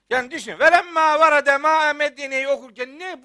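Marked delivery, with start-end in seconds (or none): 0.6-2.5 imitating a hafız belting out the verse, louder; a mocking take on overblown loud recitation; mock shouting reciter. Preacher mocks a hafız who shouts the verse: loud mock recitation. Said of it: "Burada bağırılacak bir şey yok".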